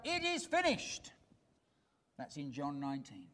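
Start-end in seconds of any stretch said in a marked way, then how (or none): 0.0-1.1 high, ringing cry of it is finished; Jesus' final cry, voiced high by the preacher. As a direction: call it out like the last cry from the cross. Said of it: "he cried out".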